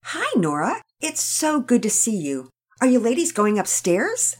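lightly voiced welcoming mom; mother greeting a visiting girl; Mama Peters, kind mother. A narrator's reading.

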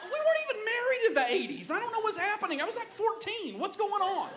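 high, fast, laughing protest; hammed-up baffled husband laughing; clueless husband. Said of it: "I'm like".

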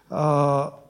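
usual speaking voice

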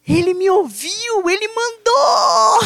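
put-on slow, rising child's triumphant cry; little girl. Six-year-old daughter exulting that Jesus answered, slow rising cry.

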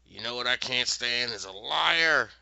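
acted anger. Mistress West turning on Miranda, acted anger. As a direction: build to a loud mock-angry finish.

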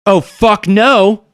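high, slow outraged cry; outraged woman. Acted. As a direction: blurt it out in horror. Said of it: "she says".

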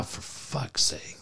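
slow, unvoiced weary whisper; whispered exasperation. Host mocks Borrego Springs fans, weary whispered sigh. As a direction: breathe it out, fed up. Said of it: "Like, ah".

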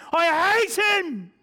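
loud, high, slow hateful yell; the damned raging at God, shouting; furious shout. Pretend. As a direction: spit out rage at God. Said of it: "they are more angry".

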